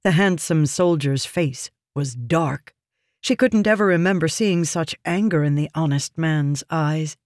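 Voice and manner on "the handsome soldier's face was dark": usual speaking voice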